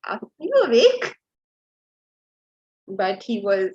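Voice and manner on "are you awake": higher, louder coaxing question; sweet wake-up call. Retold or imitated. Wife nudging her sleeping husband each morning, coaxing. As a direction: call him awake gently but insistently.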